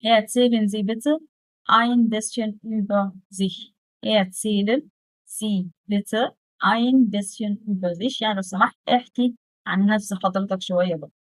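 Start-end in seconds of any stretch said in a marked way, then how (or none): 0.0-3.7 slow model reading. Shown as a demonstration. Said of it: "طب هحاول أمنتجها". 4.0-8.2 very slow, word by word German; drawn-out teacher demo; slow dictation. Shown as a demonstration. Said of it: "طب هحاول أمنتجها".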